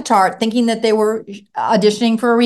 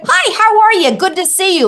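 bright, much higher and louder hello; friendly greeting; 10 semitones higher, 4 dB louder than usual. Shown as a demonstration. Parent showing a kid how to greet people, higher and louder. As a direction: greet a stranger cheerfully to model it.

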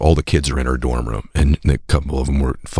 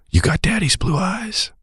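put-on soft, slowed, high baby talk; tender to newborn; 14 semitones higher, 3 dB quieter than usual. Father to his newborn son, soft and tender. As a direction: coo it like holding a newborn son.

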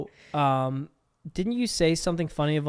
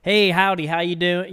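higher, louder mock Southern greeting; Southern accent; 4 semitones higher, 9 dB louder than usual. Shown as a demonstration. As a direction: call out a hearty howdy with a Southern accent. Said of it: "they could do Southern".

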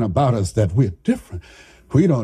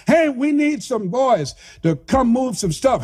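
a higher hail across the street; calling out to boys; 9 semitones higher than usual. Retold or imitated. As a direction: call out like a foreman.

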